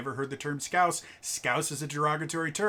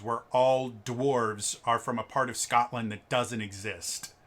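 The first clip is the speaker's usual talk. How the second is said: usual speaking voice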